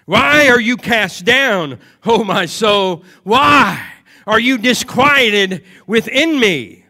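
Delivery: loud, slow, pleading shout; emphatic cry. Acted. Preacher voicing David crying out to his soul, loud and slow. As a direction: cry it out to your own soul. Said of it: "And he says in verse number five".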